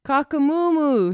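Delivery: high, loud urgent crow; a cockerel raising the alarm; shouted alarm crow. Acted. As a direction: wake the whole farm. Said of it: "shouted Cockerel".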